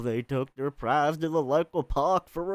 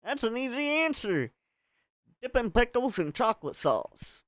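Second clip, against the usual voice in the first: southern drawl; 4 semitones higher than usual. Acted. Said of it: "she went southern".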